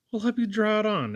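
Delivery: high, eager exclamation; a startled woman's high exclamation; exclaiming. A narrator's reading. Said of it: "exclaimed Missus Duncan".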